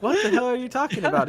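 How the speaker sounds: mock explode at the confused man; exasperated shout. Cop loses patience with the witness, a fast mock yell.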